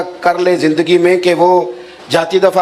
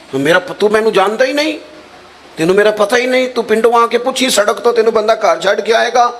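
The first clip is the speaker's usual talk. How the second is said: offended villager; 7 semitones higher than usual. Retold or imitated. Acted Punjabi quarrel, taking offence.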